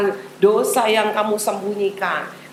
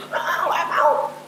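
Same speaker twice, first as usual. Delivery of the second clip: put-on higher, slow, sulky little girl; sulky refusal; 6 semitones higher, slower than usual. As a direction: whine it like a stubborn child refusing. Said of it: "Grace bilang". (anak merajuk, menolak dengan nada tinggi dan pelan)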